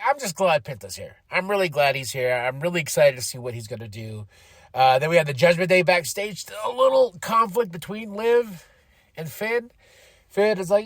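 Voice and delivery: usual speaking voice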